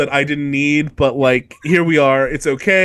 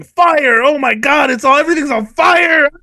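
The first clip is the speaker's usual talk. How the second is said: high, loud, frantic fire alarm; mock panic shout; 9 semitones higher, 5 dB louder than usual. Narrating the pyre catching fire, in hammed-up panic. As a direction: yell it like the place is burning.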